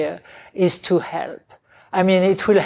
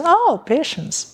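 high, bright exclamation of delight; light up as if it's a great idea; 10 semitones higher than usual. Retold or imitated. Quoting teacher's delighted reaction.

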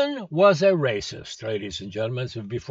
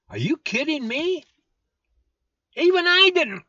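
much higher, louder disbelief; incredulous outburst; 13 semitones higher, 5 dB louder than usual. Real, not acted. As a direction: scoff at the idea.

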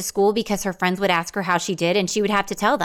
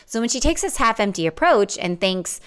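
ordinary talk

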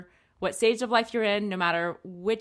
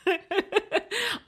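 high burst of laughter; 12 semitones higher than usual. Real, not acted. Laughing at throw-up remark.

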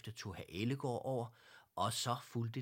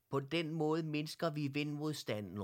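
raised, higher-pitched call; 6 semitones higher, 4 dB louder than usual. A narrator's reading. Herr Ellegaard to the cycling teddies, calling out. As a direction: call it out to the others. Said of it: "råbte Herr Ellegaard til de nye bamser".

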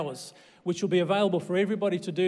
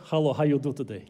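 low Russian-accented greeting; mock Russian accent; 6 semitones lower, faster than usual. Shown as a demonstration. Preacher has church greet each other, mock Russian accent. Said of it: "turn to someone around you and say".

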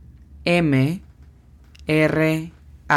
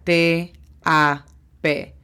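T A P spelled out slowly, letter by letter; much slower than usual. Shown as a demonstration. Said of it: "la pronunciación fonética es". (deletreo lento, letra por letra)

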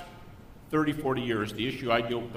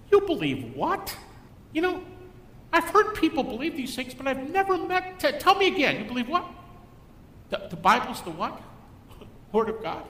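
high, incredulous sneering professor; mocking disbelief; 10 semitones higher than usual. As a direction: scoff at the student's beliefs. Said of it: "other professors do this sort of thing".

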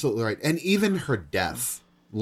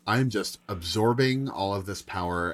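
cool disdain; 8 semitones lower than usual. Retold or imitated. Voicing the defiant character's thoughts.

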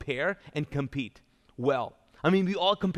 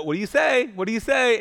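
louder imitation of an insistent parent; mock mom nudge; prompting mom; 5 semitones higher, 6 dB louder than usual. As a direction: push the child for an answer. Mom prompts little boy.

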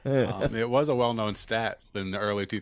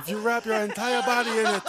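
high mock African accent, fighting laughter; mock Wakandan accent; 9 semitones higher than usual. Capping the vibranium joke, high mock Wakandan accent. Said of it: "because Wakanda isn't a real place".

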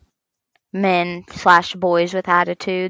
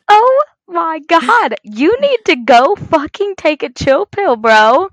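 loud, high, drawn-out outburst; acted exasperation; 8 semitones higher, 4 dB louder, slower than usual. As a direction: re-enact telling someone off in exasperation. Retold quarrel, exasperated.

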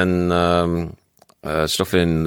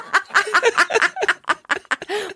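high squealing laugh. Real, not acted. Laughing at the joke.

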